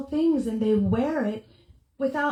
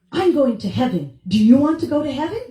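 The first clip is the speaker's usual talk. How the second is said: pushy preacher; 8 dB louder, faster than usual. Retold or imitated. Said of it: "she goes".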